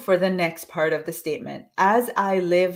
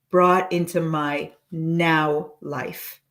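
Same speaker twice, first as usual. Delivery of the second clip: slow, steady affirmation; slow dictation; slower than usual. Shown as a demonstration. Coach dictating the affirmation's last words slowly. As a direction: lay each word down slowly. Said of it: "say it again slowly".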